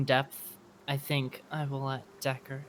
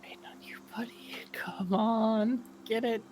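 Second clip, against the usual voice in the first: half-whispered, higher-pitched urging; hushed coaxing; 7 semitones higher than usual. Pretend. Player urging on a stealth roll, hushed coaxing. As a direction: coax it along under your breath.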